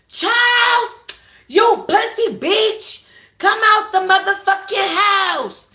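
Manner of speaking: high, loud, slow furious scream; scorned woman screaming for Charles. Acted. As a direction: scream it at him from the yard. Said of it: "she screamed".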